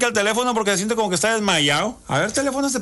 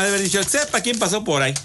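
ordinary talk

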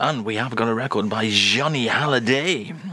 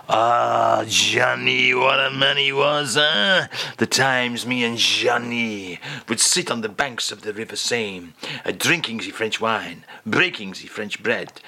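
mock French nostalgia for Paris; hammed-up French reminiscing. [name] reminisces in character.

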